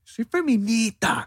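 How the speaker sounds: high, slow, dragged-out caricature; mock feminist. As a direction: stretch the word out like a smug parody. Mocking a stereotyped feminist.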